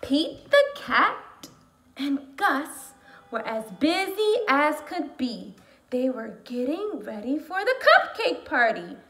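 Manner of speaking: slow, animated read-aloud; storytime reading. Acted. Teacher reading a picture book to her class, slow and lively.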